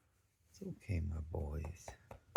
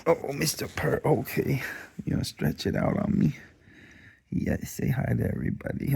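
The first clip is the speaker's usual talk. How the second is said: higher, louder playful coo; playful dog talk; 7 semitones higher, 9 dB louder than usual. Real, not acted. Owner playing with stretching dog, high playful coo. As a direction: tease the dog brightly.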